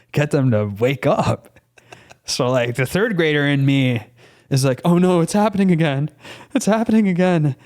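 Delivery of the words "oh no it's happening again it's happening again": rising into a high anime panic voice. Retold or imitated. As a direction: climb higher and higher like an anime character. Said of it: "like, anime voice".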